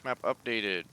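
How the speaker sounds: talking while crunching chips; words through a mouthful of chips. Real, not acted. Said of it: "Sorry for the crunching".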